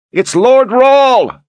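high, drawn-out rallying shout. Acted. Soldier announces Lord Rahl to the troops, shouting. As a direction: yell it slowly across an army. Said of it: "Voices carried the shout".

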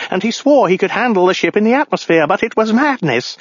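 high-pitched, frantic ranting; raving madman. Acted. Madman blaming the lobster lover for the crash, high frantic rant. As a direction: rave on in a high, agitated voice. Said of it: "the madman yelled".

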